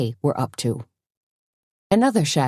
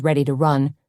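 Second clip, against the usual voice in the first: ordinary delivery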